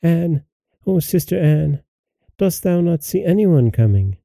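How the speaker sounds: high, restrained plea to a sister; crying out. A narrator's reading. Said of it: "and then she cried".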